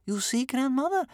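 very high, eager little voice; excited child. A narrator's reading. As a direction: burst out to grandmother, thrilled. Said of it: "said Bear Cub excitedly".

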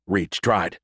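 usual speaking voice

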